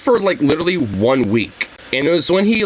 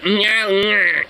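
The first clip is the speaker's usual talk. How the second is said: nasally; 4 semitones higher, slower than usual. Retold or imitated.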